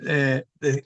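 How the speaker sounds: ordinary talk, nothing marked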